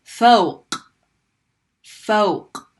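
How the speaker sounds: fawq ending on a hard uvular stop; Arabic fawq; fawq with qaf. Shown as a demonstration. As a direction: close fawq at the back of the throat. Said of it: "write a word with qaf".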